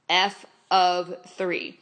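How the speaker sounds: "F of three" said slowly, each word spaced; slow, careful "F of three". Shown as a demonstration. Teacher spelling out "F of three" for the class, slow and deliberate. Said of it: "notice how I'm saying that".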